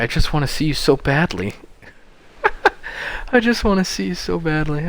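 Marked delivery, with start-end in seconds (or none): 3.3-4.9 high, hammed-up pleading; overdone yearning, pitched well up; mock yearning. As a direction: repeat it high as mock lovesick longing. Repeats line mockingly after laughing.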